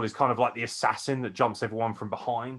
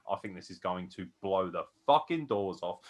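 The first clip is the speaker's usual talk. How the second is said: usual speaking voice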